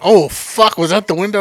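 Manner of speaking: sudden loud worry, half acted; retold alarm. Driver hearing a crash in the alley, loud alarm. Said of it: "I'm like".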